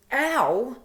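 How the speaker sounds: loud, high "ow"; sudden loud acted cry of pain; re-enacted pain yelp. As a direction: yelp as if your hair is yanked. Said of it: "it hurt".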